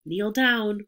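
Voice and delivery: usual speaking voice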